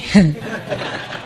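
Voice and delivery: a soft airy laugh at the joke; breathy laugh. Real, not acted. Speaker laughing at Aisha's shocked reaction, soft airy laugh. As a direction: let out a quiet breathy chuckle.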